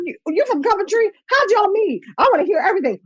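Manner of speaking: high, eager chatty voice. Retold or imitated. [name] asking about her husband, high and eager. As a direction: lightly mimic the curious star. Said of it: "She was like".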